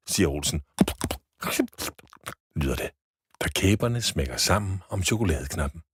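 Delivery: usual speaking voice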